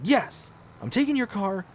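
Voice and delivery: mildly hammed-up insistence; adamant wife. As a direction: confirm it with no room for debate. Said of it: "I'm making her sound like she's, like, this crazy person".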